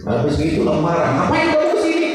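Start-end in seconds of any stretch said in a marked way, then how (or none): 1.1-2.1 high, sharp angry challenge; angry retort. Acted. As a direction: snap at the unwelcome visitor. Angry debtor confronting you. (bentakan marah bernada tinggi)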